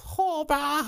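very high falsetto call; exaggerated put-on Hawaiian girl voice; mock Hawaiian girl. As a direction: pop it out in a silly squeak. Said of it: "And she opens her mouth".

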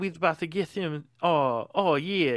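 mock Southern announcer signing off; Southern accent. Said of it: "like, weird Southern accent".

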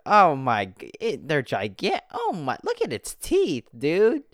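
high-pitched, loud shocked exclamations; real astonishment, voice leaping up; shocked outburst. Real, not acted. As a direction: blurt it out, stunned by what you see. Shocked reaction to fish picture.

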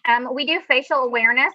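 usual speaking voice

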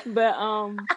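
ordinary talk

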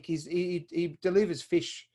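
ordinary talk

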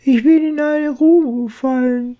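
high squeak, muffled from below; tiny mouse calling up from a pit; baby chick. Acted. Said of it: "piepste es gedämpft herauf". (gedämpftes Piepsen aus der Tiefe)

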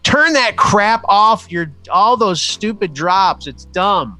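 demonstrative annoyed co-host. Retold or imitated. Co-host reacting to the sound drops, demonstrative mock rant. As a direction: imitate a fed-up co-host, stretching each phrase. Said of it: "Derek was very demonstrative about it".

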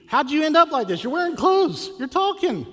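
high, loud mock disbelief; acted-out incredulous outburst, very high and loud; astonished. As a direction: exclaim it like an amazed onlooker, voice shooting way up. Townspeople amazed at the healed man.

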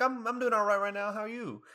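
high, sing-song cheerful reply; chirpy reply. Retold or imitated. Student answering the counselor, high and chirpy. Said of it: "I'm like".

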